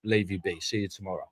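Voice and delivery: low, soft brush-off; quietly placate, as with a pat on the shoulder; humouring, placating. Retold or imitated. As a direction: gently humour someone talking nonsense. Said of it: "you pat 'em on the shoulder".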